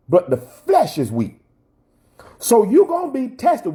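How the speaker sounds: high, ringing preacher's voice; rising into full sermon delivery; preaching. Acted. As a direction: preach it out to the congregation.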